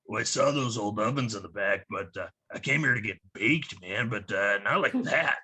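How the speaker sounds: lazy burned-out stoner voice; a low, laid-back stoner dude. Retold or imitated. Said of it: "he's like".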